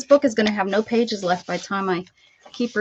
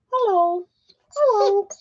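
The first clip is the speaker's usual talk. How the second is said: high dog-talk hello; 9 semitones higher, 5 dB louder than usual. Real, not acted.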